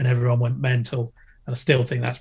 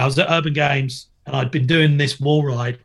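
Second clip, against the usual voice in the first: ordinary delivery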